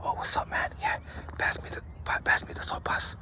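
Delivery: hushed whisper, barely voiced. Shown as a demonstration. Showing how to talk in the day room, whispered. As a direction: keep it to a whisper so nobody hears. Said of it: "you could talk like this".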